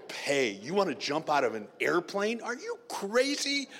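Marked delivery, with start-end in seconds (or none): 2.4-3.7 much higher squeaky disbelief; mock disbelief. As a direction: squeal it in comic shock.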